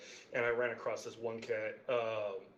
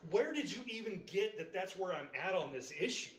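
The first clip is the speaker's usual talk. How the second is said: exasperated retort; 8 semitones higher than usual. Retold or imitated. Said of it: "I'm like".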